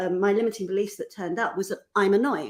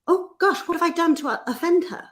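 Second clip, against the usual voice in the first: a higher, worried inner panic; anxious first thought; 7 semitones higher than usual. Retold or imitated. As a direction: fret about having offended her. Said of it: "my first thought was".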